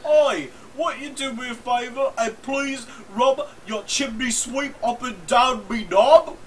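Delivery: high, loud bad Cockney come-on. Asking a lewd favour as the chimney sweep, mock Cockney. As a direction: plead in a hammed-up Cockney accent.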